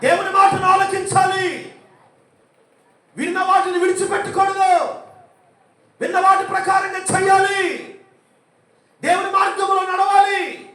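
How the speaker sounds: high, loud shouted exhortation; full-force preaching. Real, not acted. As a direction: hammer each command at the crowd. (సంఘానికి ఉచ్ఛస్వరంతో గట్టిగా బోధించడం)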